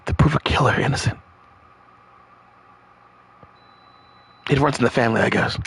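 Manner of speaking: soft, low, hushed read; low murmured story read; game dialogue reading. Acted. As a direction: read the intro text quietly to yourself. Reads game narration aloud.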